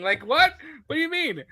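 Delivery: high, baffled what; incredulous. Retold or imitated. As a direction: rise in mock confusion. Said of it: "He's like".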